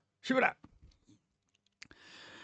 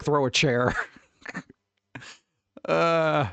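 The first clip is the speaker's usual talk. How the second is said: normal talking voice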